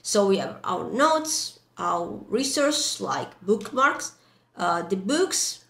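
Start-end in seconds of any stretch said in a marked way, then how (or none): none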